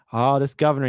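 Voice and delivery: ordinary talk, nothing marked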